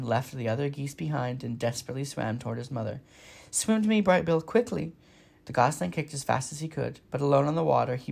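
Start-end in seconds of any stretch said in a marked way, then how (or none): 3.5-4.9 urgent call. Acted. Mother calls son from danger.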